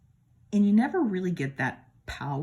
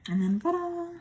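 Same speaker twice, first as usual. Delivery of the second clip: excited ta-da. Real, not acted. Presenting next card.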